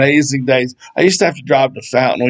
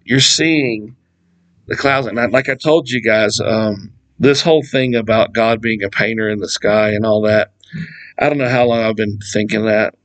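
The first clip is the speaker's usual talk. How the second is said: normal talking voice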